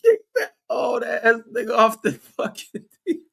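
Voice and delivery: words breaking into real laughter; cracking up mid-sentence; laughing. Real, not acted. Laughing through the story.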